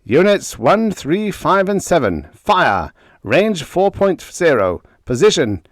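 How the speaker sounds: rising, loud triumphant orders, pitched high. A narrator's reading. Ray officer calling fire at the enemy, triumphant. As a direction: call the shots in triumph. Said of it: "rising triumphantly".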